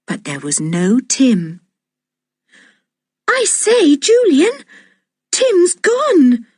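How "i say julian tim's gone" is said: high, frightened voice; alarmed, telling him the dog is gone; scared. A narrator's reading. Girl telling her cousin the dog vanished, scared. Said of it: "said George in a scared voice".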